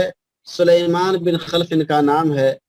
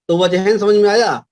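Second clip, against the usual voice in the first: normal talking voice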